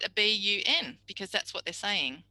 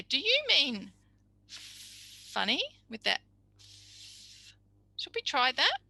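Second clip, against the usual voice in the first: coaching voice; 7 semitones higher, slower than usual. Shown as a demonstration. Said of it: "sort of role play".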